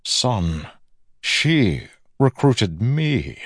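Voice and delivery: slow, pointed, rising in pitch; villain's revelation. A narrator's reading. Villain reveals the sister's role, slow and pointed. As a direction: draw the words out with relish.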